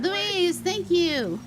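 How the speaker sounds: excited thanks. Real, not acted. Thanking a viewer on stream.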